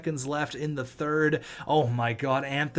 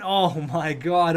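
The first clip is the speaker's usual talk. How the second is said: normal talking voice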